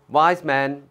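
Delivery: ordinary delivery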